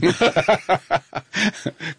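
high, amused chuckling; a run of high laughter; real laugh. Real, not acted. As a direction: laugh off the challenge. Amused at being called director.

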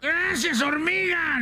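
very high, squealed donkey voice; grateful donkey. Acted. Rescued donkey thanks the ant, squealing gratefully. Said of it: "le dijo el burro a la hormiga".

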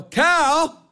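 high hollered name; noisy calling out. Acted. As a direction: holler for the servant. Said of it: "stop that screaming noise".

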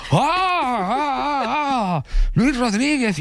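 very high, crying-out fake thrill; joining the others in a high fake squeal for a stranger; mock fake excitement. Imitating himself joining in.

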